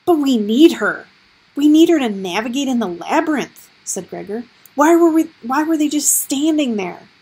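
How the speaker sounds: high, loud pleading argument; desperate protest. Acted. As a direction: argue to save her. Gregor argues desperately.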